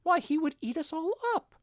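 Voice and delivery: the tiny queen squealing at the thought of a lion; alarmed mouse queen. A narrator's reading. Queen frightened.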